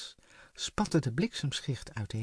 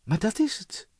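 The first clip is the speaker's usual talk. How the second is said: higher, eager exclamation; exclaimed; 7 semitones higher, 4 dB louder than usual. A narrator's reading. Floor hitting on the answer, eager exclamation. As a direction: burst out with the idea. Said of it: "riep Floor uit".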